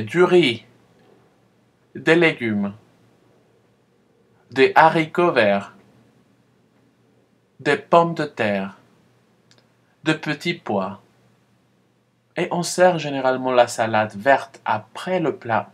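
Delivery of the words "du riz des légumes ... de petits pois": clear, spaced teacher model; model repetition. Shown as a demonstration. Teacher modelling vegetables for students to repeat. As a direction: pause after each word for the echo. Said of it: "Oui, répétez".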